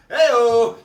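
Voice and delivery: loud, drawn-out "hey-o"; punchline catchphrase. Acted. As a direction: call it out big to cap the joke. Comedian's shouted punchline catchphrase.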